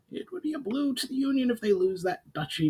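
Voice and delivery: high thoughtful goblin voice; a goblin chief thinking aloud; Brawl, musing goblin. A narrator's reading. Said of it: "mused Brawl".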